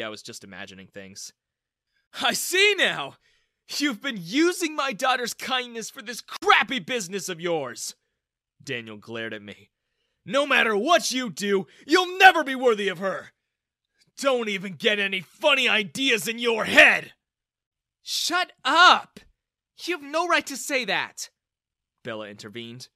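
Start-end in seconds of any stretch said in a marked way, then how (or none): 2.1-7.9 loud, high angry tirade; accusing. A narrator's reading. Father accusing man of using his daughter, accusing. 10.2-17.1 high, shouted warning; furious. A narrator's reading. Father warning young man off his daughter, furious. 18.0-21.3 high, loud angry shout; furious defence. A narrator's reading. Daughter intervening against her father, furious.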